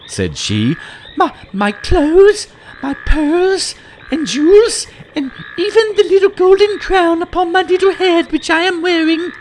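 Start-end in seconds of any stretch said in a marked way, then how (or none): 1.2-9.3 put-on very high, loud and hurried girlish offer; eager princess. Princess eagerly offers the frog her treasures, very high girlish voice. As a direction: rush through the treasures like an eager child.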